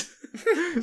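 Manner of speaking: loud high laugh; high burst of laughter. Real, not acted. Laughing at own story.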